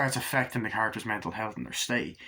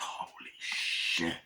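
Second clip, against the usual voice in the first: slow, soft whisper of awe; awed whisper; 6 semitones lower, 8 dB quieter, much slower than usual. Retold or imitated. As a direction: breathe it out amazed. Viewer awed by the film.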